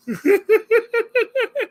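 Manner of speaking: long high-pitched giggle; real laugh, far above speaking pitch. Real, not acted. Streamer amused by blocking a chatter, high laugh.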